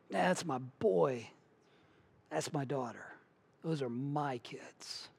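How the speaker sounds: slow, soft, hushed pride; tender proud father. Acted. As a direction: murmur it like a father beaming at his children. Voicing the Heavenly Father's delight.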